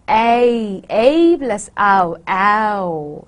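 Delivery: OW built from a drawn-out A plus ow; Cockney OW. Shown as a demonstration. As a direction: glide slowly from A into OW, eh-ow. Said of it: "Cockney, it becomes a".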